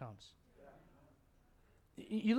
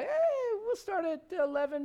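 calling out; 13 semitones higher, 6 dB louder, slower than usual. Real, not acted.

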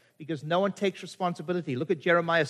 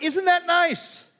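delighted; 10 semitones higher, much slower than usual. Retold or imitated. Retelling his reaction after laughing.

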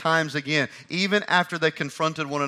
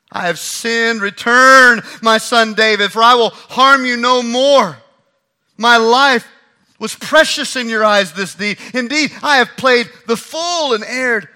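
loud, high remorseful call; hollered confession; 8 semitones higher, 13 dB louder than usual. Acted. Saul hollering back to David, loud and remorseful. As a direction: shout your regret across the camp. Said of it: "hollers back".

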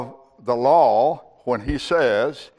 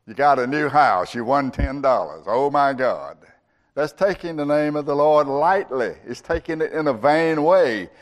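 usual speaking voice

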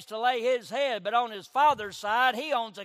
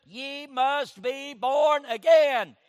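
Jesus urging Nicodemus, slow and loud; urgent shout; 3 semitones higher, 7 dB louder, much slower than usual. Retold or imitated. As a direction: drive each word home, slow and shouting. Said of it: "Jesus told Nicodemus".

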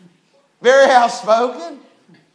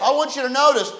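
normal talking voice

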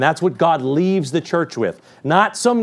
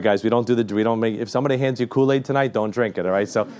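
ordinary talk

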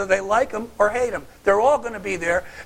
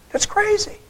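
incredulous; 13 semitones higher, 5 dB louder than usual. Real, not acted. Preacher reacting in disbelief.

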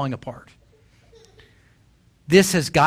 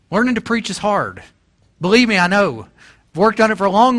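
higher and louder, impassioned sermon; full-force preaching; 6 semitones higher, 3 dB louder than usual. Acted. Preacher urging the congregation, high and loud. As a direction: drive the message home to the pews.

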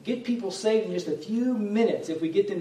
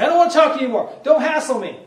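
much higher, much louder angry outburst; angry friend; 9 semitones higher, 12 dB louder, faster than usual. Retold or imitated. Friend angry at being preached to, shouting back. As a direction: snap back, fed up and loud. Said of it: "get angry at me and say".

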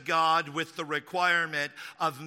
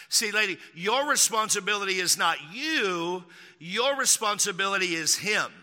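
ordinary talk, nothing marked

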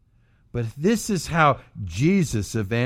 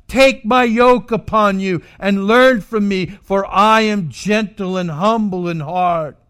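preached invitation; 8 semitones higher, 9 dB louder than usual. Acted. Quoting Jesus's invitation, preached.

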